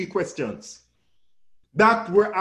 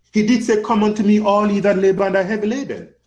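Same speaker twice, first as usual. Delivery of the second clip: raised, ringing scripture call; loud preaching; 5 semitones higher, 7 dB louder than usual. Real, not acted. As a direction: proclaim the verse loudly and higher. Preacher quoting Christ's call to congregation.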